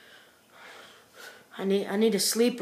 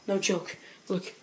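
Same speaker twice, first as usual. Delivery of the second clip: usual speaking voice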